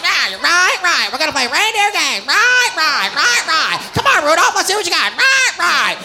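high, barking gym teacher; rally the team with repeated high coach calls; Comet. Acted. Comic plays reindeer coach starting the games, high rallying shouts. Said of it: "that fucking gym teacher".

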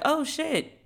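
high-pitched acted surprise; surprised realization. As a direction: relive the moment it clicks. Acting out her reaction to portrait.

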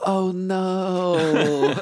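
high, drawn-out mock dismay; comic long wail of dismay; simultaneous dismay. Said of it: "both I and the bow simultaneously go".

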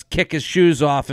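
ordinary talk, nothing marked

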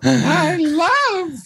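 put-on very high sweet old lady voice; doting granny voice; grandma voice. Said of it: "Grandma voice".

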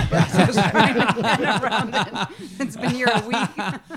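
loud joke through laughter, dragged out; cracking up at her own joke; laughing. Real, not acted. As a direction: laugh while saying it. Joke about lady on ground.

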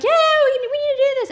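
very high excited yes; eager agreement. Retold or imitated. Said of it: "she's like".

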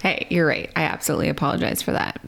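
ordinary talk, nothing marked

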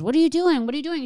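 imitating a startled pharmacy clerk; high startled mock clerk; alarmed clerk. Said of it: "the lady behind the counter goes".

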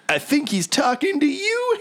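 high, teasing character voice; taunting blowhard. Acted. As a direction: toss the insult on to a rival. Character passes on the insult.

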